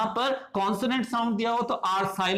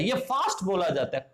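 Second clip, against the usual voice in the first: normal talking voice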